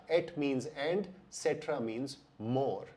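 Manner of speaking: very slow, spelling it out; slow, careful teacher delivery; slow explanatory. Shown as a demonstration. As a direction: explain each Latin part slowly. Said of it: "Latin शब्द".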